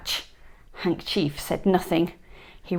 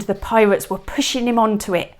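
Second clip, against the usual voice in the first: usual speaking voice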